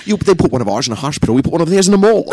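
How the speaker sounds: Sean Connery impression. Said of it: "Sean Connery is an Irish cop".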